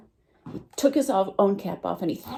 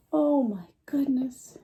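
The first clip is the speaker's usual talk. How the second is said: gasp; 6 semitones higher, slower than usual. Acted. Peddler sees monkeys with caps.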